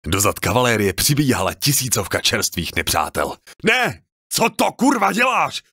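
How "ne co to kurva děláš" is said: high, loud panicked cry; panicked shout. Acted. Coriel panics as enemy reserves charge, high terrified shout. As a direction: scream it out in sudden terror.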